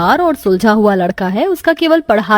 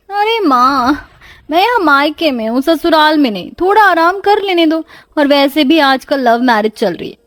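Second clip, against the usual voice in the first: protesting daughter; 3 semitones higher than usual. Acted. Daughter asks mother to let her rest, protesting. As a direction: whine lightly at mother, pitched up.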